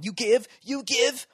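high, slow strained voice; mock strained wrestler. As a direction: grunt it out in a headlock. Brother headlock fight.

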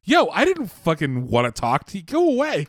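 rising into a high, panicked brush-off; spooked guy. Retold or imitated. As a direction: push them away, voice jumping up high. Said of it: "And he's like".

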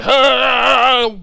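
high, loud put-on yelp; mock loud cry. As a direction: let out a sudden staged cry. Imitating the man hooking her.

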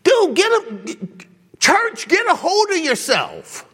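high, incredulous call to get a grip; exasperated rebuke. Retold or imitated. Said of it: "I'm just like".

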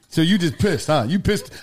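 usual speaking voice

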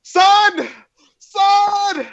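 high, loud yell for his son; calling out. Retold or imitated. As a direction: shout it across the distance. Pedro Pascal calling his son.

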